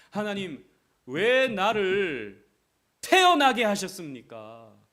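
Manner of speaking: slow, loud, drawn-out cry; anguished complaint. Retold or imitated. Jeremiah crying out to God in resentment, slow and loud. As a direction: wail it at God. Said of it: "하나님 원망하는 거예요".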